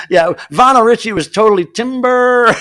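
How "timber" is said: loud, high, drawn-out holler; hammed-up tree-falling cry; lumberjack's timber call. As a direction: stretch it out like a warning shout.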